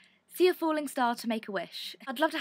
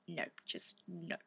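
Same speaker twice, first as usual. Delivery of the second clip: mutter; 4 semitones lower, 15 dB quieter, slower than usual. Real, not acted. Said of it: "dismisses her own failed accent".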